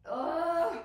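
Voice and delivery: loud, high exasperated ugh; sudden loud annoyed groan; frustrated groan. Real, not acted. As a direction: groan it out, fed up with the allergies. Frustrated by allergies mid-talk.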